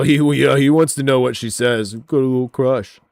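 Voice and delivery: loud, gleeful mockery; loud teasing. Real, not acted. Mocking the YouTuber's eager reply, loud and gleeful. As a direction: rib him about his little crush.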